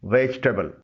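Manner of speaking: वेजिटेबल said as "vej-tbl", in two short syllables; full correct pronunciation. Shown as a demonstration. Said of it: "मुकम्मिल तलफ़ुज़ है".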